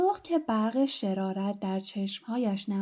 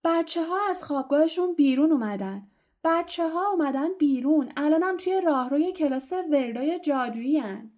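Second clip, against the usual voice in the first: high, raised tattling cry; shouting; 6 semitones higher, 3 dB louder than usual. A narrator's reading. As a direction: call it out down the whole corridor. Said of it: "زیرا بدعنق فریاد زد". (فریاد خبرچینی با صدای زیر)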